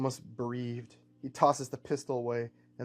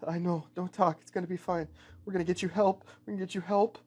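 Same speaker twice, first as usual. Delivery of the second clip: higher-pitched, anxious comforting; acted panic while trying to soothe; frantic reassurance; 6 semitones higher than usual. Min tells wounded friend not to talk, frantic reassurance.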